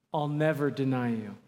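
usual speaking voice

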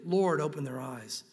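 low, slow, prayerful plea; a quiet prayer said for someone; hushed prayer. Pretend. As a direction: pray it gently, low and slow. Preacher voices a quiet prayer.

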